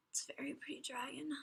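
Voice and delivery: whisper. Real, not acted. Soft admiring whisper.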